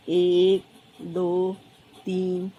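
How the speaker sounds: low, drawn-out count, one number at a time; low monotone count with gaps; counting aloud. Acted. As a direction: count along slowly as you draw, pausing after each. Acts child counting while drawing stars.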